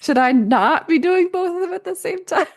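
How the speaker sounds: high, loud mock alarm; mock worried surprise. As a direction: play the sudden doubt as a hammed-up squeak of panic. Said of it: "And I'm sitting there like".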